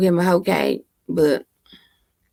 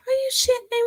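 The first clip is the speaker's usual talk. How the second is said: shocked disbelief; 4 dB quieter, faster than usual. Real, not acted. Disbelief at robot voice comment.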